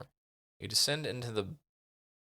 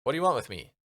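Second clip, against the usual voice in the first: firm demand; 5 semitones higher, 4 dB louder, much faster than usual. Shown as a demonstration. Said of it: "I feel like".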